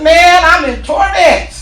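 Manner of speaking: a damned man pleading loudly; anguished cry. Retold or imitated. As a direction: drag out the agony, loudly. Rich man crying out from hell.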